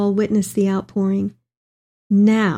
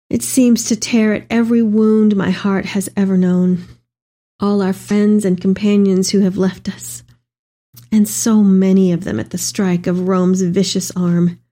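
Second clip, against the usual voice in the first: ordinary delivery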